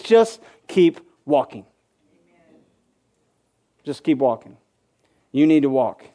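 calm them like a patient father; gentle voice of God. Retold or imitated. God telling the discouraged believer to keep going, low and gentle. God's answer voiced softly.

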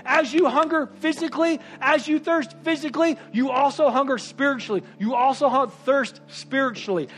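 loud, high, driving sermon delivery; preaching at full force. Acted. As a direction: preach it hard and fast to the crowd.

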